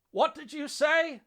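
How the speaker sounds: high, loud, drawn-out yell; defiant, urgent shout. A narrator's reading. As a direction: call back with defiance and urgency. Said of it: "his tone a mixture of defiance and urgency".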